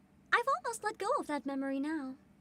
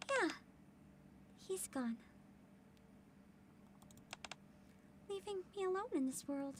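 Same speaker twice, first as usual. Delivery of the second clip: much softer grieving words; quiet sadness; 14 dB quieter than usual. Acted. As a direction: let it fall quietly. Character recalls lost brother.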